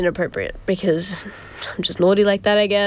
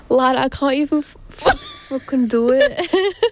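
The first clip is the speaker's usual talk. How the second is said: higher, hammed-up British lad; clumsy British accent that falls apart; attempted British accent; 6 semitones higher than usual. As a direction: badly fake a British accent, breaking into laughter. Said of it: "do a British accent right now".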